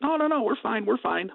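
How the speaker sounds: very high, hurried-sounding reassurance; retold brushing off. Upset colleague insisting to caller all is fine, high mock reassurance. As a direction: imitate someone waving off a worried caller. Retelling a colleague's dismissive reply.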